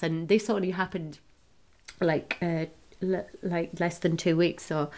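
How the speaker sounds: usual speaking voice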